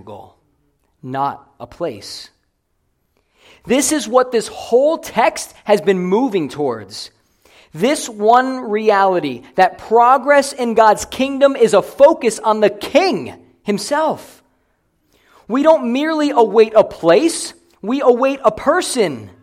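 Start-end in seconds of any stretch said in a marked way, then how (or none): 3.7-17.5 loud, high, driving sermon delivery; raised preacher's voice, hammering the point; full-force preaching. Real, not acted. 17.8-19.3 loud, high proclamation; emphatic preaching. Real, not acted. Climax of the preached point.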